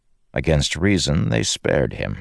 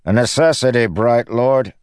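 raised, projected lordly reply; stronger voice; 8 semitones higher, 5 dB louder than usual. A narrator's reading. Local High Lord to the protesting owner, strong voice. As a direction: answer firmly and loudly across the distance. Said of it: "a stronger voice said".

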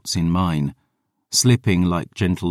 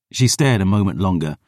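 plain reading voice

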